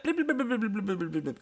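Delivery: rapid, high-pitched run of blips; imitated guitar-pick scrape. Shown as a demonstration. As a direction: mimic a pick dragged up a guitar string. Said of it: "sounds like someone dragging a guitar pick".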